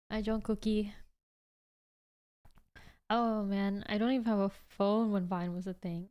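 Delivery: ordinary delivery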